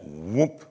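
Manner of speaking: swooping whoop sound effect; vocal whoop for a sudden change; imitated whoop. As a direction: make a quick whoop that slides upward. Imitates Jesus suddenly transfiguring.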